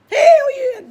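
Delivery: very high, loud hello; put-on phone greeting. Storyteller answering the president's call, hammed-up high greeting. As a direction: greet with exaggerated brightness.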